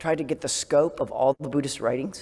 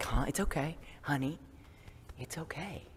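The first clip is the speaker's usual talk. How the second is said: much softer, trailing into a whisper; tender comfort; 13 dB quieter than usual. Retold or imitated. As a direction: cradle the words like to a child. Comforting herself when startled.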